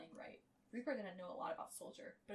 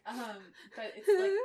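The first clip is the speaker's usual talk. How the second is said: half laughing, half crying; 4 semitones higher, 17 dB louder, slower than usual. Real, not acted. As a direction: laugh through the words. Laughing while describing fic.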